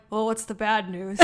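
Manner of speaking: put-on quiet Minnesota-accented worry. Voicing the Midwesterners' first thought, Minnesota accent. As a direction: fret softly in a Minnesota accent. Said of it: "a Minnesota accent".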